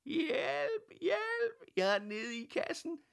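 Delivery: very high, tiny muffled cry for help; small voice from a box. A narrator's reading. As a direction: squeak for help from inside. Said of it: "lød det igen inde fra kassen".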